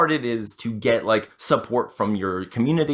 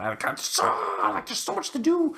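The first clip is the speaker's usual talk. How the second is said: strained, very high mock whine; hammed-up groan of being swamped; overwhelmed venting; 3 dB quieter than usual. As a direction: squeal it out in comic exasperation. Venting about being overwhelmed, tired.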